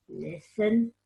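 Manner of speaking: usual speaking voice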